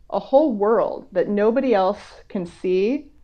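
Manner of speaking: ordinary talk, nothing marked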